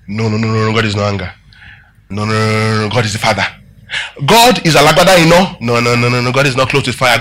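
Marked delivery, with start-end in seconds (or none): 0.1-1.3 gently correcting Jesus. Acted. Preacher voicing Jesus correcting the disciples, low and soft. 2.1-3.5 gently correcting Jesus. Acted. Preacher voicing Jesus correcting the disciples, low and soft. 5.6-7.2 gently correcting Jesus. Acted. Preacher voicing Jesus correcting the disciples, low and soft.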